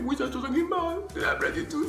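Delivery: high squeaky voice, half-laughing; lightly mocking the crying girl's voice; mock tearful girl. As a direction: imitate her whimpering that it's a joke. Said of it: "Mais tu pleures?".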